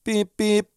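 high-pitched beep-beep like a horn; imitated car horn. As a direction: honk twice like a car horn. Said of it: "honked his horn".

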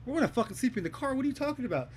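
high, disbelieving retort; incredulous protest. Retold or imitated. Drunk narrator scoffs at Val, high disbelieving retort. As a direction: scoff at the idea of going home.

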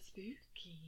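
soft whispered spooky; Moira's spooky. Retold or imitated. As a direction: breathe it out dramatically. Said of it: "The way she says it".

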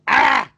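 sudden loud high angry "Ah!"; angry outburst. Acted. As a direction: blurt out a mock burst of anger. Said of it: "just one outburst".

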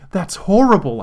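loud high outraged shout. Acted. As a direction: yell it in outrage. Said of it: "I shouted".

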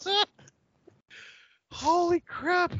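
high, slow, breathless from laughter; after laughing hard. Real, not acted. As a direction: still catching breath from laughing. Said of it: "My face hurts from laughing".

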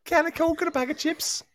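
high-pitched, amateur Scouse; attempted Scouse accent. Shown as a demonstration. Host showing the one accent bit he can do, rough Scouse. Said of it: "I'm not good at accents".

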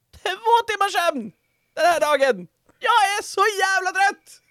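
high-pitched, overwrought shriek, building; exhausted ranting. Real, not acted. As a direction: wail it out, at the end of your rope. (gällt, utmattat utbrott)

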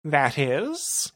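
high villain voice, slowing for effect; theatrical villain threat; gloating villain. Acted. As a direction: deliver the threat with relish. Narrator voices Rumble.

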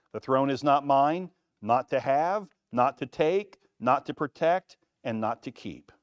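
each "not" rising and pressed; the "not" in each phrase stressed; emphatic list. Acted. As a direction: stack the "not to" phrases, building. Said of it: "[name] added emphatically".

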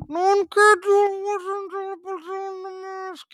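muffled, loud, high masked villain voice; Bane impression. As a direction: do Bane through a mask, booming.

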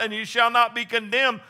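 ordinary talk

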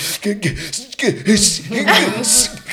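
high, loud gasps for air; mock panicked gasping. Showing herself in cold water, panicked gasping. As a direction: imitate frantic cold-shock gasping. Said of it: "you watch me right now".

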